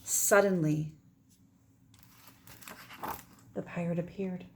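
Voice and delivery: lively read-aloud; story narration. Acted. Teacher reading a picture book to her class, expressive narration. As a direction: carry the children through the tale.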